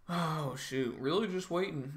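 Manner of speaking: ordinary talk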